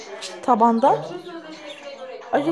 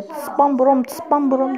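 imitated bird call; 5 dB louder, much slower than usual. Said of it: "kızık sesli".

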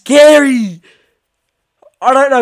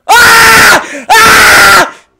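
terrified scream; 11 semitones higher, 8 dB louder than usual. Real, not acted. Jump scare, screaming.

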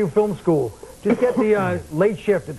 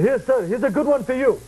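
higher-pitched imitation East Indian accent; 5 semitones higher than usual. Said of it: "that was my East Indian accent".

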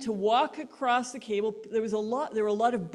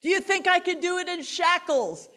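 loud, high challenge to the crowd; showman's call; 7 semitones higher, 4 dB louder than usual. Retold or imitated. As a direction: egg the crowd on.

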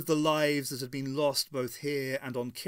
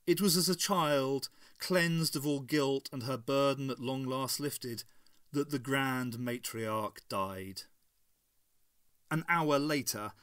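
usual speaking voice

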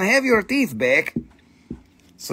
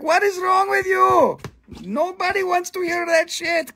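high-pitched, exasperated mock scolding; exasperated outburst; 14 semitones higher than usual. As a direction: cry out in disbelief at dull sellers. Acting out exasperation at dull sellers.

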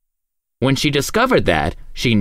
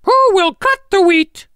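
high, louder brisk hen call; a quick no-nonsense call for help; the busy hen rallying the animals; calling briskly; 4 dB louder than usual. A narrator's reading. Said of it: "calling briskly".

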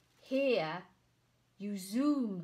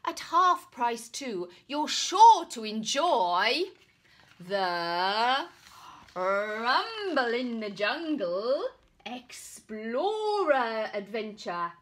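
loud, showy sales pitch; salesman pitch; 10 dB louder than usual. Acted. Shopkeeper selling the adventure, loud hard sell. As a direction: sell it like a market trader.

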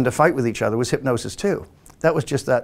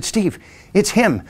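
urgent friend; 10 semitones higher, slower than usual. Retold or imitated. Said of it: "He said".

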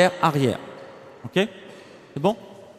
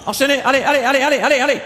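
raised, rapid-fire cheering on; urging on; 5 semitones higher, much faster than usual. Real, not acted. Coach keeping players linking actions, urging on. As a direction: push them along with higher, unbroken repeats.

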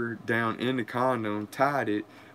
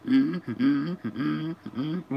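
imitating a stupid laugh; 4 semitones higher, 4 dB louder, much slower than usual. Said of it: "fucking stupid ass laugh".

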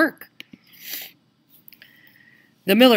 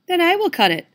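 high, louder hen voice; little red hen voice; 11 semitones higher, 5 dB louder than usual. Acted. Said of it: "said the little red hen".

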